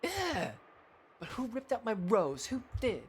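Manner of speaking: put-on high, upset little-girl voice; high petulant child's whine; whiny child. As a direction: demand an answer like a wronged kid. Child demands who took her rose.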